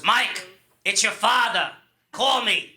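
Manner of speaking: put-on slow, curt New York accent message; imitated dad's New York accent voicemail; old-school New York accent. Dad's birthday message to his son, New York accent. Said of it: "and the message was".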